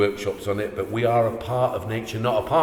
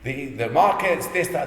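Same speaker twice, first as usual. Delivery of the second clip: mock naysayer; 6 semitones higher, 4 dB louder than usual. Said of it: "someone like this saying".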